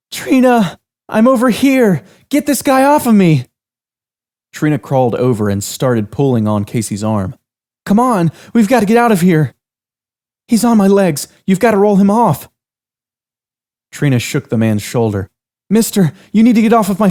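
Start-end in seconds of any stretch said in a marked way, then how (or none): 0.1-3.5 high, loud yell, pinned down; yelling for help. Acted. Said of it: "Cassie yelled out her friend's name". 7.8-12.5 higher, louder panicked voices; urgent and scared, trying to get free; frantic young women. Acted. Two trapped girls plead.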